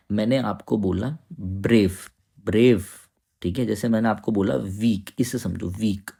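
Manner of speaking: normal talking voice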